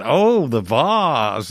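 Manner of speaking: slow, drawn-out "the vase" said "vahz" in mock Yankee accent; a seller mocks the Yankee's "vahz", slow and drawn out. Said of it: "the guy said".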